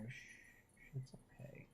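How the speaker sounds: hushed, breathy shh to calm a pet; soft reassuring whisper; soothing whisper. Real, not acted. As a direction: whisper it gently to a squirming cat. Soothing the held cat.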